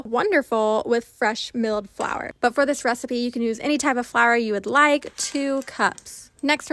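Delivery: loud upbeat recipe narration; voiceover. Acted. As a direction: read it like a cooking show host. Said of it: "switching over to voiceover".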